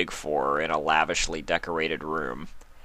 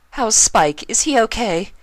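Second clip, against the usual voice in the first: high, louder worried questions; desperate for news; anxious Rarity; 8 dB louder than usual. A narrator's reading. Rarity asks about Spike.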